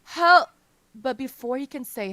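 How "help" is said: piercing loud high scream; cry for help. A narrator's reading. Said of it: "Shelion cries out".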